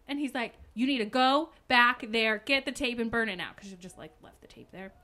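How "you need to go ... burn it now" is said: loud, insistent command; imitating an alarmed boyfriend giving orders; urgent order. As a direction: lay down the order forcefully. Retold boyfriend orders her urgently.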